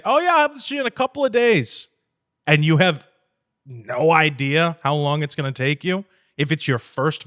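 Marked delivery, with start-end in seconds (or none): 0.0-1.9 high, quick chirpy yes-man voice; overeager promise. Retold or imitated. Mocking a naive videographer promising fast delivery, chirpy high voice. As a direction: promise breezily, too fast. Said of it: "if you say".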